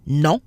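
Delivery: nasal "non", open vowel through the nose; French nasal "non". Shown as a demonstration. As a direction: say "non" with the n left silent and nasal. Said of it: "N O N".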